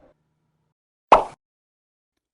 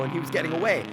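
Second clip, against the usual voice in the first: usual speaking voice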